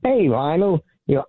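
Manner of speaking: normal talking voice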